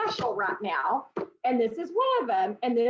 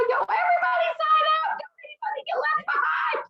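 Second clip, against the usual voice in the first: much higher, loud, crying through the words; tearful excited shout; 4 dB louder than usual. Real, not acted. Host urges the call to join up, crying out high and loud. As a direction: plead with everyone through happy tears. Host near tears urging sign-ups.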